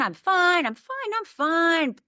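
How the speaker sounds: the cheerful mask saying she is fine, high and light; outside Rhonda. Retold or imitated. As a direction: chirp it like a girl pretending all is well. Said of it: "the outside Rhonda".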